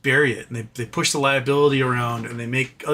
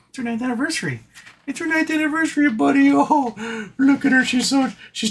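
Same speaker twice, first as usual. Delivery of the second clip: much higher, cooing to the monkey; doting pet talk; 11 semitones higher than usual. Real, not acted. Owner cooing to her pet monkey on its anniversary, high and doting.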